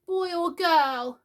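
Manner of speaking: put-on high, slow, timid little boy; not too hopefully. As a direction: ask it hardly daring to hope. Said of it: "not too hopefully".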